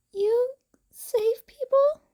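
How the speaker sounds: put-on high, quick child asking; a small girl's eager question; curious little girl. Eri voiced again.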